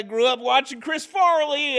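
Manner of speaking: high, loud, eager answer; excited self-quote. Retold or imitated. Newcomer answering the worker's karate question, high, loud, eager. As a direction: blurt it out eagerly. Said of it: "And I said that, I go".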